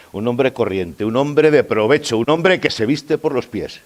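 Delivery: cada vez más alto y fuerte; enumeración creciente. Acted. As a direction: encadena la lista subiendo tono y volumen en cada frase. Enumeración cómica que va creciendo. (enumeración enfática in crescendo)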